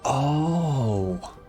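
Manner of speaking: ordinary talk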